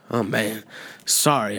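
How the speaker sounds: ordinary talk, nothing marked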